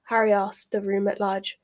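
ordinary delivery, nothing marked

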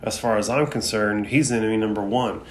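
ordinary talk, nothing marked

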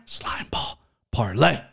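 hushed, voiceless whisper; conspiratorial whisper; whispered reveal. Pretend. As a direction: whisper it like a secret pick. Hushed reveal of his pick.

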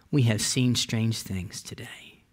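awestruck, hushed whisper; awed whisper. Acted. Preacher whispers quote in awe.

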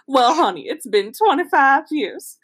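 high, slow, hammed-up Southern drawl; bad Southern accent. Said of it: "in a bad Southern accent".

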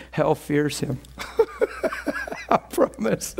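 ordinary talk, nothing marked

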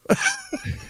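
very high squeaky laugh; real squealing laugh. Real, not acted. Laughing at own punchline.

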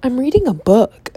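mock amazement. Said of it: "cause I'm like".

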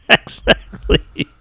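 high, breathless laughing word. Real, not acted. Laughing hard.

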